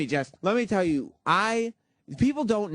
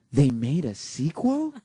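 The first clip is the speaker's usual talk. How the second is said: slow, astonished question, imitating someone clueless; wide-eyed disbelief; 2 semitones lower, slower than usual. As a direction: draw it out like stunning news. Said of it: "wide-eyed, and she said these words".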